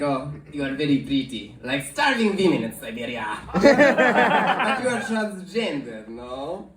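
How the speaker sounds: low-pitched Russian charmer; Russian man's mock-courtly voice; Russian accent. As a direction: flatter her in a thick Russian accent. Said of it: "that's what Russian people sound like".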